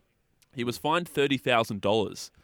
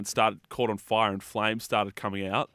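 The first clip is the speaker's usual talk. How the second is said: usual speaking voice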